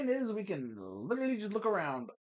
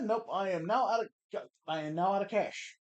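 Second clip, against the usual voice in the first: ordinary delivery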